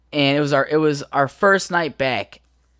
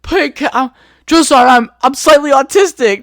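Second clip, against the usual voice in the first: higher, louder frantic begging; panicked pleading; 9 semitones higher, 8 dB louder than usual. Retold or imitated. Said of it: "I was like".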